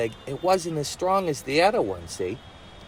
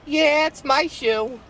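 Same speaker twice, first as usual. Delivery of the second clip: put-on much higher, slow girlish voice; timid Betsy voice; 11 semitones higher, slower than usual. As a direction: admit it sheepishly, high and slow. Betsy admits it to Hank.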